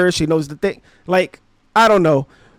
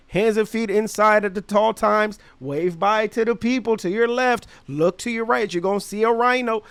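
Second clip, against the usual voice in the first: higher, recited safety spiel imitation; ride operator; 3 semitones higher, 5 dB quieter than usual. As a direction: reel off the attendant's scripted announcement. Operator's scripted safety announcement.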